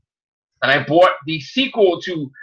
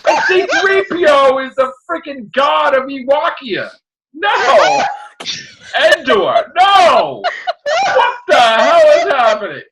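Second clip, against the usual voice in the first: mock outraged rant; 6 semitones higher, slower than usual. Fan ranting about the Ewoks, high and indignant. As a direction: rant like a betrayed little fan.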